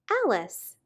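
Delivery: usual speaking voice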